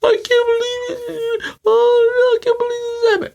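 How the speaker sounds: shrill high falsetto wail, slowed; mocking overdramatic sports fans, crying falsetto; mock hysterical fan. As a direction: whine like a hysterical fan crying over a brawl. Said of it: "thing that really gets me annoyed".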